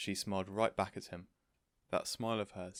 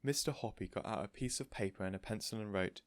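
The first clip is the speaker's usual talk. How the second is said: plain reading voice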